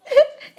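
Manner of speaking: a sharp, high laugh; a quick high laugh at herself. Real, not acted. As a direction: laugh at getting lost. Laughs about using Google Maps.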